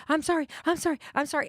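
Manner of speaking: imitating my own frantic apology; flustered apology. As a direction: gush out sorry while out of breath. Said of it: "And I'm like".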